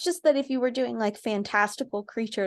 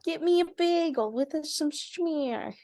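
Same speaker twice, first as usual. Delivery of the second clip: put-on New York accent; 6 semitones higher, slower than usual. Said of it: "that sounds more New York".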